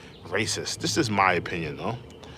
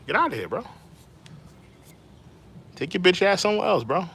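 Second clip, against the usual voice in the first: higher, faster brush-off; dismissive kick-out; 9 semitones higher, faster than usual. Real, not acted. Streamer throwing a troll out, fast and dismissive. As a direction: shoo the troll away.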